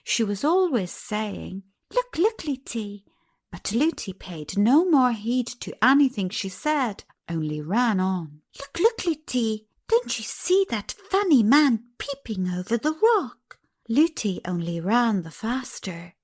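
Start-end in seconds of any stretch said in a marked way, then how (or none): 1.9-3.0 excited calling. Acted. 8.6-13.6 put-on higher eager child calling; excited calling.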